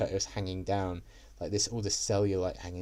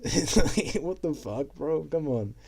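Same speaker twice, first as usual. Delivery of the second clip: laughing disbelief; 8 semitones higher, 5 dB louder, slower than usual. Real, not acted. Incredulous at the firefighter's question.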